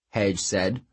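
usual speaking voice

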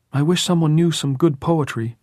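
put-on high, loud boy's voice. A narrator's reading. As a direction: wish aloud, pitched well up.

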